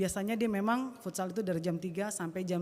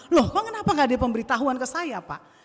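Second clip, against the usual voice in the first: loud, higher, indignant re-enactment; retold heated objection; angry protest; 5 semitones higher, 9 dB louder than usual. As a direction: challenge the coach in disbelief. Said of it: "saya marah sekali".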